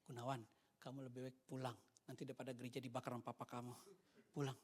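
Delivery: low, soft imitated pastor; hushed urging. Pastor to young Gunawan during service, hushed urging. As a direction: quietly send someone away, voice dropped. Pastor quietly sends him home.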